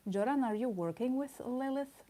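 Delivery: usual speaking voice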